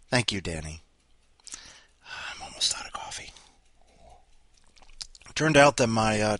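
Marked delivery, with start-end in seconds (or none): 2.0-3.3 breathy unvoiced whisper, almost inaudible. Real, not acted. Host mutters an aside to listeners in a whisper. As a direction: whisper it to yourself as a throwaway aside.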